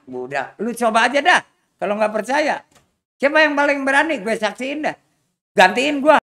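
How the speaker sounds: loud taunting dare; daring challenge. Acted. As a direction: goad the gang to try. Boss dares his men. (tantangan keras bos begal kepada anak buahnya)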